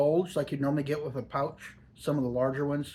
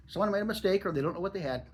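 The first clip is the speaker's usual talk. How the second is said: usual speaking voice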